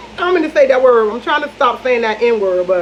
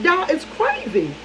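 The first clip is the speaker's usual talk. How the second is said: slow disbelief; 6 semitones higher, 6 dB quieter, slower than usual. Real, not acted. Disbelief at ex's story.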